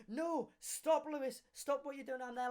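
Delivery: imitated pleading teacher. Teacher jumps in front to stop Lewis, high mock plea. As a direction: plead for a halt in a lifted mimicking voice.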